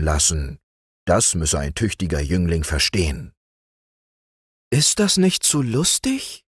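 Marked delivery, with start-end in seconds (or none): 4.7-6.4 high, worried widow's question; timid, high-pitched worry; anxious question. A narrator's reading. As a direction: ask it anxiously, pitched well up. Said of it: "fragte die Witwe ängstlich".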